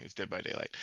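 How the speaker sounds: whispered, sheepish correction. Real, not acted. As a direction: say it under your breath. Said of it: "I'm sorry".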